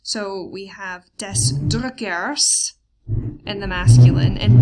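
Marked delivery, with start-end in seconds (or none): 1.2-2.7 slow, careful des Drückers; slow model reading. Shown as a demonstration. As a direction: read des Drückers slowly, every sound clear. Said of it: "we take endings for".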